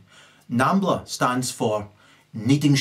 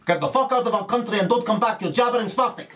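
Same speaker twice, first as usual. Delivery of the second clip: fast, high-pitched scolding; mock angry expulsion; 7 semitones higher, faster than usual. Next country throwing the newcomers out, mock fury. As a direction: send them packing in mock outrage.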